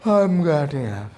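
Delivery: I am getting up said very slowly; slow demo. Shown as a demonstration. Teacher showing students what to say on waking. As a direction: model the sentence slowly. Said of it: "तो बोल दो".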